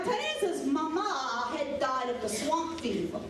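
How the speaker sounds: plain reading voice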